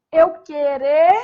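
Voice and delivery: a loud, drawn-out Eu querer. Shown as a demonstration. Teacher stressing the infinitive to students, loud and slow. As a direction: punch it out for the class. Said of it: "Aqui eu pus um re".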